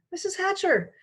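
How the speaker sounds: high, eager calling; eager girl calling out, high. A narrator's reading. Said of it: "Sheila called".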